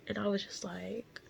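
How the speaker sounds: ordinary talk, nothing marked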